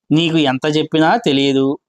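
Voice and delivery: ordinary talk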